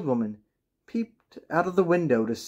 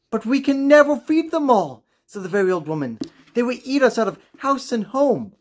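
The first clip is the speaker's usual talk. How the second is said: high, louder fretful voice; worried old woman; 9 semitones higher, 4 dB louder than usual. Acted. Old woman warning her husband they can't feed the cats, high and worried. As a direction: fret aloud as the old woman.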